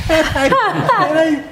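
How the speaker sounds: words broken up by laughter; giggling while telling the story; laughing. Real, not acted. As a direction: laugh through it, high and slow.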